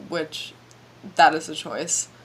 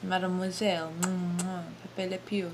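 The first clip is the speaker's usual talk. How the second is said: cartoonish mock French accent. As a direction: ham up a silly French accent with a stagey laugh. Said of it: "What about this French accent?".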